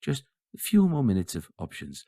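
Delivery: slow mock phone-menu recording; unhurried, faux-friendly hold-line voice; automated voice message. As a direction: lightly imitate a drawn-out menu announcement. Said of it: "the automated voice message".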